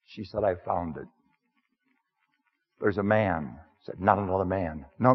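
lightly voiced humble outsider, plain-spoken; first-person sermon character. Preacher speaking to the congregation as the Samaritan woman's man, lightly voiced. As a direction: step into the role of the woman's man, understated.

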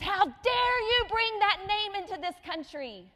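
loud, high, furious outburst; angry shout. Retold or imitated. Angry Aussie man yelling at the speaker in Burger King. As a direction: bellow it at her face.

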